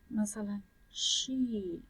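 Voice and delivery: شی said slowly and softly as a sh; sh sound. Shown as a demonstration. Teacher demonstrating the sh sound of a consonant. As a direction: stretch شی out gently.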